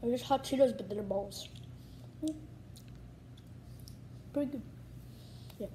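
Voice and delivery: licking fingers between words; talking while chewing. Real, not acted. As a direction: mouth partly full of snack. Seen 0: food put in mouth at -4, chewing movements throughout words.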